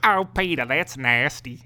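loud Southern-tinged cartoon voice; Cleveland from Family Guy. Shown as a demonstration. Showing off the impression to the co-host, loud Cleveland. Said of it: "a little bit of Cleveland in here".

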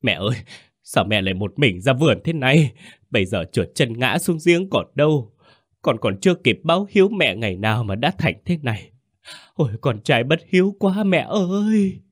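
showy mourning that swells into a high cry; put-on wailing. A narrator's reading. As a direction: fake a grieving son's wail, building in pitch. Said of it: "cố ý gào khóc".

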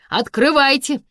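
loud, high command to open up; official order. A narrator's reading. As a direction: order them through the door. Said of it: "велели из-за двери".

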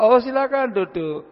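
lightly voiced welcoming host; host's reply. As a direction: play the host inviting him to sit. Host answers the visitor.

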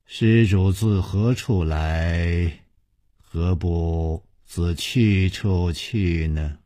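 slow, drawn-out, measured nun's voice; smiling, serene and very slow; serene nun with a hint of a smile. A narrator's reading. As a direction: intone it slowly, like an unhurried Buddhist master. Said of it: "素心大师含笑说".

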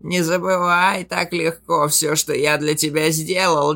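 cold sorcerer voice. Acted. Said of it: "бросил Шадрайт".

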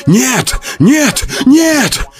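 panicked denial, pitch soaring; rising panicked no. A narrator's reading. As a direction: scream it in mounting horror. Realizing he is dead.

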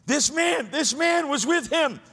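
high-pitched, urgent callout; sudden accusing cry. Acted. Servant girl suddenly recognizing Peter, high accusing cry. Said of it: "all of a sudden she".

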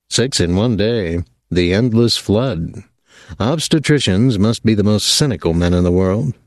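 usual speaking voice

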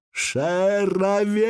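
high, long, despairing call; desperate drawn-out cry. A narrator's reading. Dying elf's last cry before falling, drawn out desperately. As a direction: stretch the word out high in desperation. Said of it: "крикнуть ещё раз, громко, протяжно, отчаянно".

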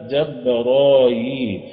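جبرايل drawn out as "Jabraa-yiil"; non-canonical variant. Shown as a demonstration. Teacher showing a rarer reading, جبرايل with long drawn vowels. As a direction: stretch the aa and final ii of جبرايل, chant-like. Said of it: "ده بقى في غير الإيه؟".

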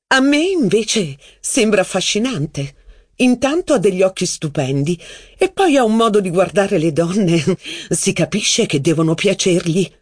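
high, provocative coquette; teasing female voice. Acted. Mistress provoking jealous Robert about the waiter. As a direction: tease him with a high voice.

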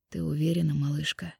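husky man's voice, softly; husky young man. A narrator's reading. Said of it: "хрипло спросил парень".

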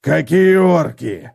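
barking. Acted. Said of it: "рявкнул он".

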